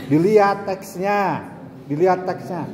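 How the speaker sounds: ordinary talk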